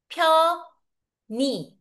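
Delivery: pyo-ni-jom slowly, syllable by syllable; linked pronunciation. Shown as a demonstration. Teacher sums up how to pronounce it, slow syllables. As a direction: break it into pyo, ni, jom with clear pauses. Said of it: "Ang pag-pronounce".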